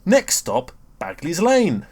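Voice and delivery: lightly hammed bus stop call; imitating a cheery bus driver; enthusiastic announcer. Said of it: "he'll have that really enthusiastic tone".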